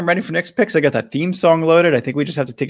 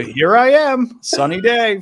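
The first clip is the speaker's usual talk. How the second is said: imitating Sunny Day; 8 semitones higher, slower than usual. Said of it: "from Mario Golf that really just set me off".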